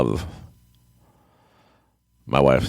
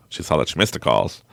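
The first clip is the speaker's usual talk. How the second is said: ordinary talk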